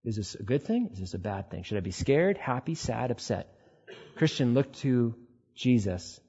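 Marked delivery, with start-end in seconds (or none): 0.1-3.5 lightly voiced unsure child, hushed; small, tentative questions, trailing lower; child asking parents. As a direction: ask softly, checking for a cue. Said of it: "They will look to their parents to go".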